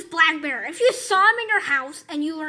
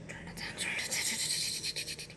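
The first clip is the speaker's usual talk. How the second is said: slow whisper to himself; 12 dB quieter, slower than usual. Real, not acted. Adjusting the screen brightness while recording, whispered aside. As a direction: breathe it out under the breath, slowly.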